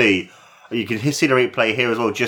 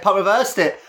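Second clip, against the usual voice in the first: excited; 8 semitones higher than usual. Real, not acted. Repeated louder.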